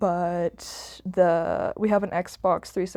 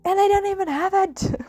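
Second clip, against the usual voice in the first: incredulous; 12 semitones higher than usual. Real, not acted. Laughing complaint, pitch jump.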